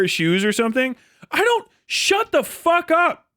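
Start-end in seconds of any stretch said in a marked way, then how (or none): 1.3-3.3 exasperated. Real, not acted. Fed-up outburst at the news.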